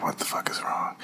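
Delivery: stunned, hushed inner panic; whispered freak-out. Retold or imitated. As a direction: whisper it to yourself as you flip out.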